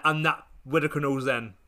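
ordinary talk

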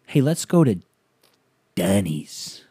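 pause, then drop the last word like a bombshell; mock dead-serious suggestion. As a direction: imitate a friend proposing it with grave weight. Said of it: "looks at me dead in the face".

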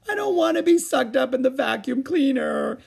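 put-on a mild tearful little-girl plea; sobbed. A narrator's reading. Little girl frightened of the vacuum, sobbing. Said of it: "she sobbed".

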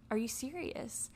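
soft, no strength left to yell; drained disbelief. Retold or imitated. Woman to cheating boyfriend, too numb to yell, soft disbelief. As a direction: ask it quietly, worn down. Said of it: "didn't even have it in me to, like, yell at him".

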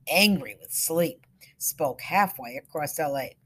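ordinary delivery

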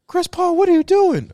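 high, loud incredulous cry; mock disbelief. Joking at a celebrity's cartoon cameo, mock disbelief. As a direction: shoot the pitch up as if aghast.

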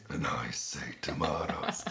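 low, slow, breathy answer; deep voice reply. Retold or imitated. Mimicking the deep-voiced host answering the soprano. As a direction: drop into the booming host voice. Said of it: "And he would say".

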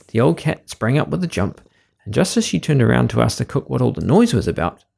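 ordinary delivery

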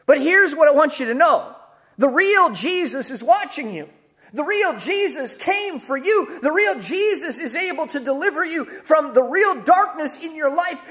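rising, urgent preacher build; fervent preaching. Acted. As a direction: drive the point home with fire.

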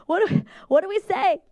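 mock panic. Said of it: "I'm like".

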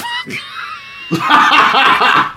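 high shrieking laugh; real high-pitched shriek of laughter. Real, not acted. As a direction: burst out in a squealing laugh. Shocked laugh at Thomas Jane.

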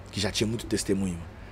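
ordinary talk, nothing marked

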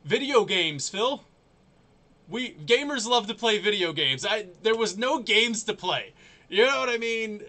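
loud, high exasperated outburst; exasperated rant. Real, not acted. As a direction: vent loudly in disbelief.

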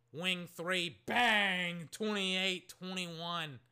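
loud bang then slow score; excited call. Real, not acted. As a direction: call the three like a broadcaster. Called made three.

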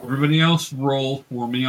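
ordinary delivery

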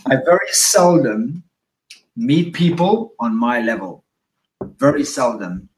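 slow, measured and deliberate; slowed right down. Real, not acted. Host calming himself after getting too excited, slowed down. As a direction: rein it in, pausing between phrases. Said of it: "I gotta slow myself the fuck down".

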